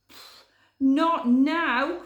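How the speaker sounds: loud, slow scoffing pfft; excited dismissal. Acted. Hare dismissing Podgy's fear, excited loud scoff. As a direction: brush off the fear eagerly. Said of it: "cried Hare excitedly".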